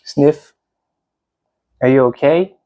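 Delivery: plain reading voice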